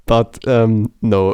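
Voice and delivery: usual speaking voice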